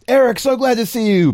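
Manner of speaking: high, loud, beaming welcome; brother Jason. Acted. DM as Jason welcoming brother Eric home. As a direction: greet your long-lost brother with open arms. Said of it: "so Jason's like".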